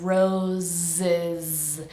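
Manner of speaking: "Roses" drawn out, both S's buzzing as Z; vibrating Z. Shown as a demonstration. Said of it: "both of these S's are pronounced like a Z".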